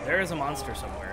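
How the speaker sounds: ordinary talk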